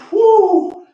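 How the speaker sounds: loud high cheer; excited whoop. Real, not acted. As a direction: whoop through the burn. Whoop mid set.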